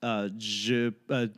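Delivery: fast, apologetic tourist French, pardonnez-moi; halting schoolbook French with an American accent. Shown as a demonstration. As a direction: rattle off your best classroom French. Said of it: "the big thing you wanna learn is".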